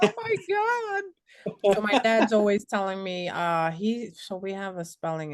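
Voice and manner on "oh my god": high, slow swooning gasp; drawn-out gush. Retold or imitated. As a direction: stretch it out like you're melting. Retelling own gushing reaction.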